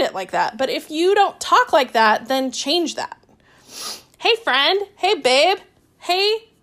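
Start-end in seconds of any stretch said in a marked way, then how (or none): 4.2-6.5 higher, put-on friendly hellos; mock chummy greetings. Coach sampling greetings for a client's message, playfully chummy. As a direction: try out cutesy greetings, pitched up.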